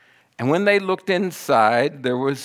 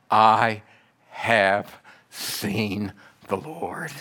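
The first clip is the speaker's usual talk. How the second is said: very slow, word by word; awed announcement; 3 semitones lower, much slower than usual. Acted. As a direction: let each word stand alone, falling low. Mary's announcement to the disciples.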